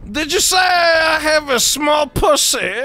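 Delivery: mock Kazakh accent; Borat. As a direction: put on a comic foreign-visitor accent. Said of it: "Yo, Borat".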